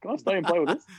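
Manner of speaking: put-on high-pitched kid begging to play; hammed-up boyish wonder; excited young boy. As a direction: mimic a wide-eyed thirteen-year-old. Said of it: "He's thirteen-year-old".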